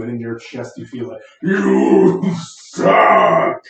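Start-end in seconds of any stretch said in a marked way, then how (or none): 1.4-3.6 deep, booming, ground-shaking roar; thunderous booming voice. Acted. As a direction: boom it out like thunder. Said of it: "this thunderous voice that shakes the ground".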